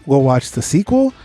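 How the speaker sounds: ordinary talk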